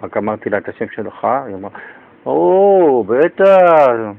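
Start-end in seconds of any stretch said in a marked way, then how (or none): 2.2-4.1 drawn-out, pitched-up "או, בטח"; mock delighted reaction. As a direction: stretch it out in delight. Principal's delighted reaction retold. (תגובה נלהבת ומתמשכת)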